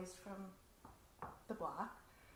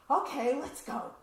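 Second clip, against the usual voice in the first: eager yes; 6 semitones higher, 12 dB louder than usual. Retold or imitated. She eagerly agrees.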